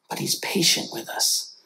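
soft tender whisper. Real, not acted. Preacher assuring the church God waits, whisper. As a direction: breathe it gently to the listeners.

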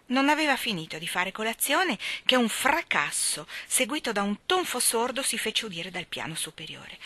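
usual speaking voice

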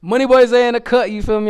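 loud hype host intro. Acted. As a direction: announce yourself like a street interviewer.

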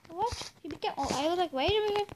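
ordinary delivery

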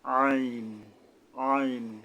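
আইন squeezed out from mid-throat. Shown as a demonstration. Teacher models the Arabic letter, throat-squeezed আইন. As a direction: press the throat to sound a long আইন. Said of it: "গলা চেপে আদায় করতে হবে".

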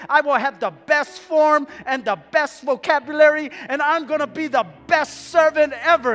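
loud, building vow after vow; fervent promising. Acted. Hanging man bargains with Jesus for rescue, fervent loud promises.

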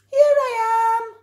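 very high, loud, small-voiced bear calling; high squeaky teddy voice. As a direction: call out to be found. Bear calls out from dump.

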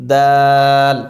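দাল said loud with a long stretched vowel; letter name drawn out. Shown as a demonstration. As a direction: hold the আ in দাল for three counts. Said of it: "টান শুনে হয়তো বুঝতে পেরেছেন".

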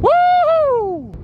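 high loud woo; excited cheer. Real, not acted. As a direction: cheer the summit. Reaching the summit.

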